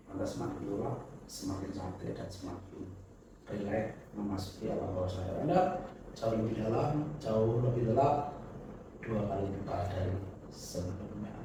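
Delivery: very soft, low hypnotist voice; hypnotic suggestion. Acted. Deepening after countdown.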